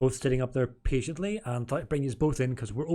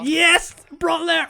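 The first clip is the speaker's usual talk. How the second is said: much higher and louder whoop; excited cheer; 9 dB louder, much slower than usual. Real, not acted. Host delighted by the guest's curse, loud high cheer. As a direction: cheer him on at the top of your voice.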